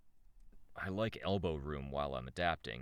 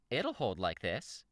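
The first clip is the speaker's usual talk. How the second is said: slow character voice, moderately raised pitch; character voice, found; 5 semitones higher than usual. Acted. Reader has found Shamrock's voice, slow character delivery. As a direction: draw it out, a little less high-pitched. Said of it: "I think I got it".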